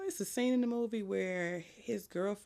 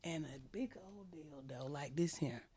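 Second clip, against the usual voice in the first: hushed whisper, spilling the dirty detail; 4 semitones lower, 13 dB quieter than usual. Real, not acted. Friend whispering a shocking movie scene, hushed. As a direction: lean in and whisper it like a secret.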